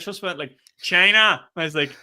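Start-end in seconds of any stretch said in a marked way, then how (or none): 0.8-1.5 imitated shout. Mimicking the heckler again.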